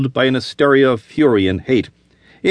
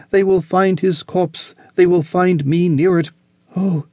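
higher, urgent pleading woman; frightened woman; 5 semitones higher than usual. Acted. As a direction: rush your plea in terror.

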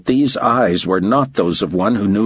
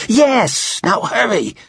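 high, impatient outburst; exasperated; 14 semitones higher, slower than usual. A narrator's reading. Director hurrying the motor pool man, exasperated. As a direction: cut him off and hurry him along.

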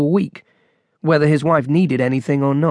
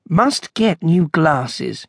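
ordinary delivery

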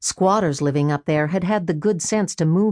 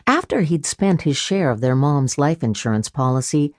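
ordinary delivery, nothing marked

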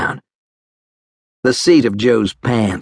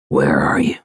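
much lower, calm reply; 7 semitones lower than usual. A narrator's reading. Medic answers a hidden wounded soldier, low and calm. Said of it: "Preacher's voice was low and calm".

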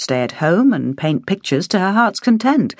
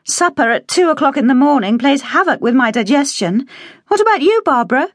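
higher voiced young woman, chatty; higher, light, a little fussy; young woman's voice lifted higher; Penny; 10 semitones higher than usual. A narrator's reading. Penny keeps talking.

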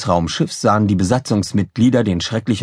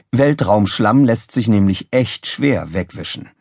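ordinary delivery

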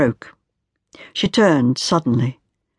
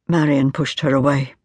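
usual speaking voice

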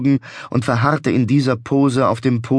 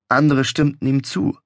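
ordinary delivery

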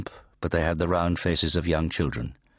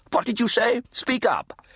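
put-on high, demanding deaf old priest; fat Redeemer. A narrator's reading. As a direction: bark at the boy to speak up.

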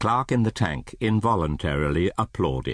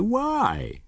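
very high, baffled question; crying out. Acted. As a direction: protest with voice shooting up. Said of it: "cried Scrooge's nephew".

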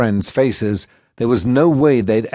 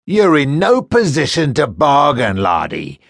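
menacing first mate; 5 semitones higher, 3 dB louder than usual. Acted. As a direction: draw out the threat like a cruel jailer. First mate mocks Nakulo.

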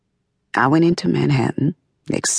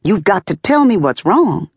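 higher, urgent mother's plea; frightened plea; 7 semitones higher than usual. Retold or imitated. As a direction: push it out, scared for her son. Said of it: "frightened now".

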